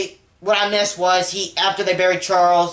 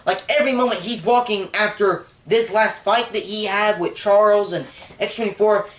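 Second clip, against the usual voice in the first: normal talking voice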